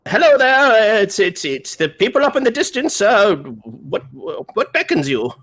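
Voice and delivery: high, loud hail easing down; calling out. Acted. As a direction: hail distant strangers, then settle into the question. Calls message to distant stranger.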